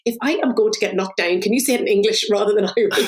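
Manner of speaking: usual speaking voice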